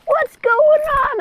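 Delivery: very high, squeaky imitation of a little boy; hammed-up high child voice; mock scared kid. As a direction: play a bewildered kid in a very high voice. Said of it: "This kid's like".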